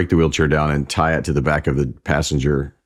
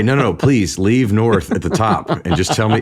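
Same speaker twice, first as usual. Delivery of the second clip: exasperated dad; 6 semitones higher than usual. Retold or imitated. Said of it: "drove my dad crazy".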